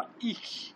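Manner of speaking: whispered "ich" ending in a sharp hiss; wrong hiss. Shown as a demonstration. Teacher whispering a too-sharp "ich" as the wrong way. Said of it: "এরকম না, soft".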